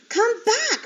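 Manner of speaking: very high, loud yell. A narrator's reading. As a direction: yell after them. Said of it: "Flora yelled".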